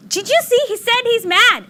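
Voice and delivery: high-pitched thrilled reporting; excited retelling. Retold or imitated. Mother telling the babysitter her son said mad, thrilled. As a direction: burst out with the big news, voice way up.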